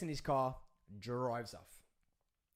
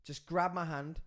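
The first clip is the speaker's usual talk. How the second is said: usual speaking voice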